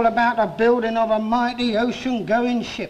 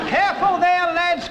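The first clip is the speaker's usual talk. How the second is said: foreman's cry; 7 semitones higher than usual. Retold or imitated. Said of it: "cried the foreman".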